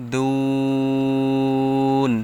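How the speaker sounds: দুন with the vowel stretched very long and slow before the stop; দুন টেনে পড়া; long madd stretch. Shown as a demonstration. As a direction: drag the উ of দুন out for three counts. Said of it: "তিন আলিফ টেনে পড়তে হবে এখানে".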